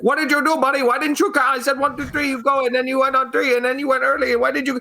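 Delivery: frantic, very high-pitched mock scolding; imitated angry yelling. Security guard berating his helper over the dropped body, high mimicked yelling. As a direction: rattle off the blame in a squealing, high voice. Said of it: "he's yelling".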